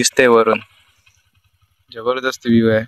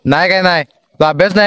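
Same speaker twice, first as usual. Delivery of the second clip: shouting across a distance; 6 semitones higher, 7 dB louder, faster than usual. Real, not acted. Calling across to kids playing cricket at dusk, loud shout.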